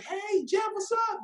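lightly put-on high greeting; acted friend greeting. Friends invite him to hang out after work, mock high greeting. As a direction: imitate a friend's high-pitched hello.

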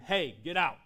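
mock scream from a story; retold scream. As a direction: yell a warning to scare off an intruder. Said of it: "I scream".

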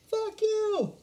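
high, ringing "Fuck you" from the crowd; mock crowd jeer. Retelling the crowd's reaction: high mocking jeer. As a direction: jeer it like an angry fan. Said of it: "And they're like".

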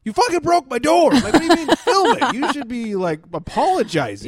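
high, loud mock-furious rant; imitated outraged yelling. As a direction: act out an incredulous husband blowing up. Acted angry husband confronting friend.

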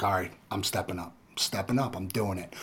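hushed, low retold pep talk to self. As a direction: psych yourself up under your breath. Retells psyching himself up before shot.

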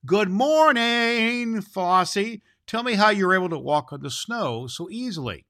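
Claus greeting the deer. A narrator's reading. Claus calls from his door to the deer, high loud greeting. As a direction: call out brightly across the snow to the deer.

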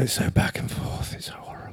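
unvoiced weary whisper. Real, not acted. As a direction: breathe it out despairingly. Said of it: "sighs about anxiety".

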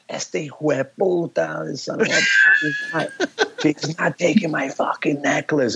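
imitating mom's furious Spanish outburst, high and hot. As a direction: snarl it like an enraged mother. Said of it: "my mom's like".

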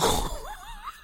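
high breathy burst of laughter. Real, not acted. Mum laughing at toddler's beard, high laugh. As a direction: let a high laugh escape.

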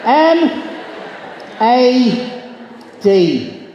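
"M", "A", "D" shouted one by one, the first high; letters called out. Shown as a demonstration. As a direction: call out each letter to the room. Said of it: "just repeat the following".

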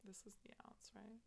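unvoiced whisper while pouring. Real, not acted. Measuring liqueur.

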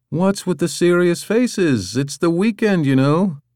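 Dick voiced. Dick arrives cheerfully.

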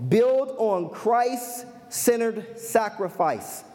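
drawn-out delivery with gaps between phrases; measured, deliberate pace; slow, spaced-out emphasis. Real, not acted. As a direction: announce the point slowly, letting each part land. Reads out the point slowly, then repeats.

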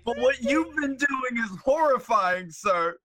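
bad British accent. Co-host echoing the wizard's scolding, high hammy British accent. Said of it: "like, the worst British accent".

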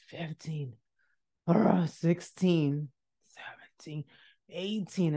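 slow, hushed counting under the breath; dreading count. Retold or imitated. Student counting doors on the dreaded walk, slow and hushed. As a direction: count off slowly, nearly whispering.

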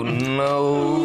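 long drawn-out "no"; mock slow-motion cry, drawn out. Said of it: "As time slows".

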